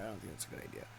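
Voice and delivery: breathless whisper under his breath; whispered repeat. Retold or imitated. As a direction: repeat it to yourself, barely voiced.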